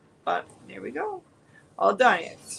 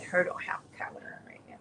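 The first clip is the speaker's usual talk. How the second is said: soft whispered pet talk; 9 dB quieter, faster than usual. Real, not acted. Whispering baby talk about collarless dog.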